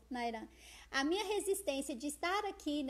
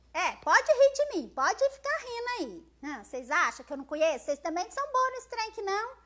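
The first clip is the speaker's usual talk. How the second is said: playful mock indignation; 2 semitones higher, 7 dB louder, faster than usual. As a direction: tell off the laughers in mock offence. Mock challenge to viewers laughing at her.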